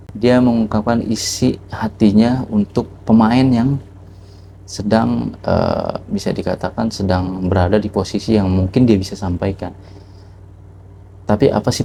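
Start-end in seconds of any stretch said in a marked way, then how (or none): none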